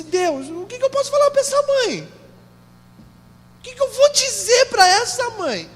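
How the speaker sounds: high-pitched, helpless appeal to God; retold desperate prayer, high and straining; anguished plea. Retells his helpless cry to God.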